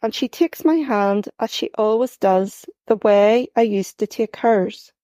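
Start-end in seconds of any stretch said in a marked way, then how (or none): none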